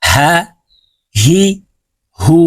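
হা, হি, হু with h from the bottom of the throat; careful Arabic small ha drill; h from the throat's start. Shown as a demonstration. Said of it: "এই হা হলকের শুরু থেকে উচ্চারণ করতে হবে".

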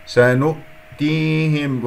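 سنؤتيهم slow, voice shut off after nu; slow recited سنؤتيهم; hamza closure demo. Shown as a demonstration. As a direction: close the throat on the hamza in سنؤتيهم. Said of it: "وقفل".

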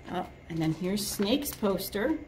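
usual speaking voice

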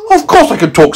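high, cheeky squeak; meteorite voice. A narrator's reading. Meteorite retorts to scientist, high cheeky. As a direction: answer as a proud little rock. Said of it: "said the meteorite".

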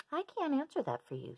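high gentle starfish voice. Acted. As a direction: answer kindly in a high voice. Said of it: "said the starfish".

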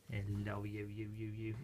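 low hushed coo to a puppy; soft cooing. Real, not acted. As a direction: murmur it tenderly. Cooing at puppies.

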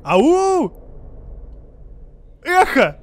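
loud high shout; calling from far away. Real, not acted. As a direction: call out to test the echo. Shouting for the echo. (громко крикнуть в пещеру)

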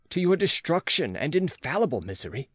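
ordinary delivery

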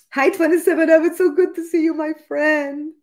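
high, loud, delighted hello; excited greeting. Real, not acted. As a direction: call out happily to a friend just arriving. Greeting a friend joining the live.

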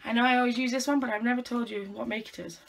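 usual speaking voice

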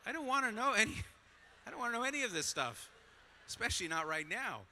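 high, loud, squirming protest; hammed-up plea to be spared the details; comic exasperation. Acted exasperated thought at dinner.

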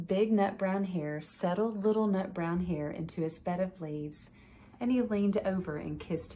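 gentle bedtime story read-aloud; reading to children. A narrator's reading. Judge reading a picture book to children, gentle storytelling.

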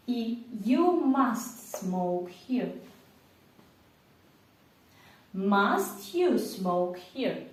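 very slow, every word separate; slow repeat-after-me. Shown as a demonstration. Teacher leads the class in saying it aloud. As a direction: say it slowly so students can repeat. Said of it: "вимовте це все вголос".

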